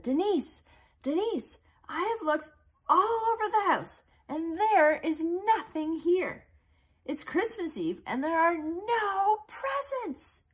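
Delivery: put-on high, worried girl's voice on the phone. Girl calling her friend about missing presents, worried high voice. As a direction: sound like a panicked little girl.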